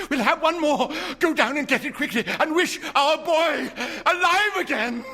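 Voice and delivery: high, loud, laughing urgency; frantic laughing demand. A narrator's reading. Wife urging her husband to wish, high and frantic. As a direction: beg and order at once, half laughing.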